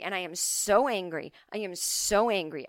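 ordinary delivery

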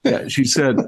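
ordinary talk, nothing marked

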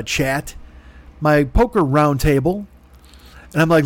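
ordinary talk, nothing marked

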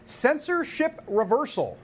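high, slow, drawn-out headline announcement; headline read. Acted. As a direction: announce it like big breaking news. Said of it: "Just the News headline".

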